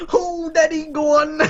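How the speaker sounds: high, wailing hammed-up birth cries; mock labor moaning. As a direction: overplay a woman in labor, whimpering. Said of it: "This is what I'm like when I'm giving birth".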